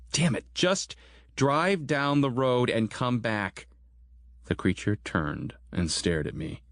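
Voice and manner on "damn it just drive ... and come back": higher, slow yelled order; yelling. Acted. The narrator yells at Grant to drive away and come back, higher and slow. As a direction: shout the order back toward the van. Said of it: "Then I yelled".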